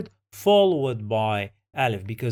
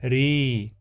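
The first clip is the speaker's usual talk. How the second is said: ordinary talk, nothing marked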